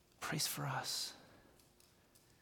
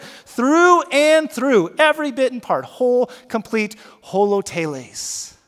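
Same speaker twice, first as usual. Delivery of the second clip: through and through slow, higher and louder; emphatic reading; 6 semitones higher, 9 dB louder than usual. Shown as a demonstration. Pastor reading the NIV phrase with emphasis. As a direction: proclaim it slowly. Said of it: "I like how it says it".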